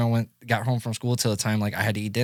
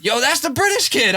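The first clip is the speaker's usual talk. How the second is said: high, loud eager exclamation; thrilled recognition, pitched way up; excited; 13 semitones higher, 6 dB louder than usual. Retold or imitated. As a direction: burst out with excitement. Spotting the new kid.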